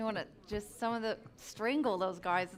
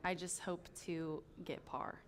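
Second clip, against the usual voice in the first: whispered aside; 3 semitones lower, 10 dB quieter than usual. Real, not acted. Self-deprecating aside on golf.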